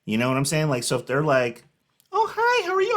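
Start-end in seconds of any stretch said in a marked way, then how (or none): 2.1-2.9 very high-pitched phony hello; exaggerated high social-greeting voice; fake friendly greeting. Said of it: "I kinda go a little bit above".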